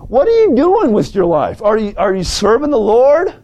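high-pitched, insistent pulpit questions; preaching challenge. Real, not acted. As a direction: press the congregation with each question.

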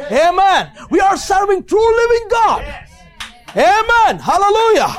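high, rising preacher's cry; fervent preaching. Real, not acted. Preacher assuring congregation God takes care of them, fervent high-pitched exhortation. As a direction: build to a ringing, high-pitched amen.

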